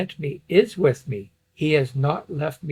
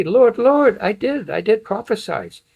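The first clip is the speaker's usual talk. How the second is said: high, loud acted appeal; pleading protest; 8 semitones higher, 6 dB louder than usual. Followers defending themselves before the Lord, pleading. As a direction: protest urgently, pitched well up.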